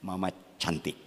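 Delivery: soft sweet husband reply. Retold or imitated. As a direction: answer her sweetly and quietly. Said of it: "Istrimu ngomong".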